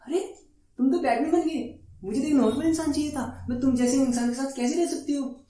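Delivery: high-pitched imitation of a complaining woman; mock complaining girlfriend. Batman's girlfriend now wants a normal man, mock high-pitched complaint. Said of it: "उस दिन बोली".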